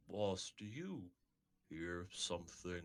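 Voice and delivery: low, soft, slow question; wary Oscar. Acted. Oscar asking his boss about a noise, low and hushed. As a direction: ask the boss quietly and slowly.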